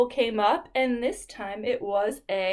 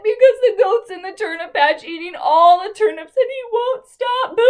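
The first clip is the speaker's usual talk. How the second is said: fast, high, louder wail; crying animals complaining to the wolf; 8 semitones higher, 8 dB louder than usual. Acted. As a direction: blurt it out in a hammed-up sob. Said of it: "they said".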